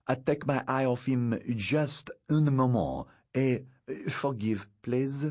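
put-on hesitant apology in a French accent; apologetic French girl. Sophie excusing her lapse in watching, apologetic French accent.

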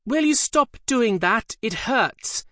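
put-on high, childish whine of a girl demon; petulant piping voice. A narrator's reading. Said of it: "petulantly, and in a distinctly feminine tone".

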